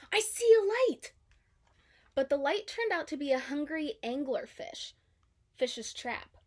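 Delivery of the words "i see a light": high, loud excited call; excited cry. A narrator's reading. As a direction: shout it, thrilled in the dark. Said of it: "Dory cried".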